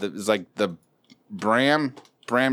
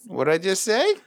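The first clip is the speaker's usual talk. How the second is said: higher voice rising on "say"; upward inflection; 7 semitones higher than usual. Shown as a demonstration. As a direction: push "say" up into a question. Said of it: "do an upward inflection at the end".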